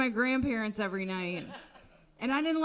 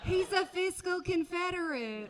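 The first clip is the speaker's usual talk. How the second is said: higher, breezy Southern wife justifying her husband; Georgia wife; 6 semitones higher than usual. Retold or imitated. Comic imagining a Civil War wife talking about her husband, breezy then exploding. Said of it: "a wife in Georgia who's like".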